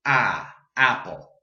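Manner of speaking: ordinary delivery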